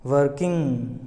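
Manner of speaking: वर्किंग with a nasal ng, no ga; correct ng. Shown as a demonstration. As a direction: model वर्किंग. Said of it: "वर्किंग बोल सकते हैं".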